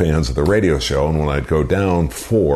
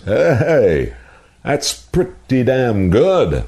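much higher and slow, drawn out praise; impressed; 9 semitones higher, much slower than usual. Retold or imitated. Gary May reacting to the impression, impressed and slow. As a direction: let the surprise stretch out.

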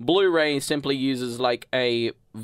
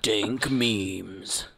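deep epic-voice catchphrase; awesome voice; 4 semitones lower than usual. Pretend. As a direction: drop low for a mock-epic pronouncement. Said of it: "in my awesome voice".

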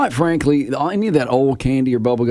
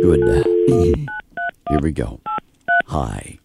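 automated voice. Pretend. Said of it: "pretend to be an automated voice telemarketer".

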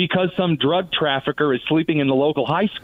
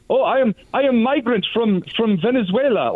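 higher-pitched mock Arabic accent; 7 semitones higher than usual. Commentator imitating a migrant from the Iranian regime, mock Arabic accent. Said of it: "he says in an Arabic accent".

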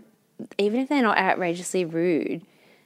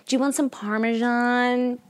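slow, higher American waiter voice; put-on American server accent; 4 semitones higher, slower than usual. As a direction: offer it like a US server, drawn out. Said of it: "I just wanted to say".

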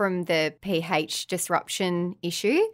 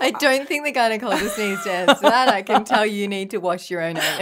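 talking through laughter; giggling while speaking; laughing; 3 semitones higher, 4 dB louder than usual. Real, not acted. Joking about washing anus.

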